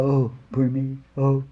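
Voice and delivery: imitated drawn-out moaning "oh"s; woe-is-me moaning, put on; mock self-pity. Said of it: "and they go".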